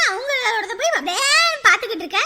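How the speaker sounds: ordinary talk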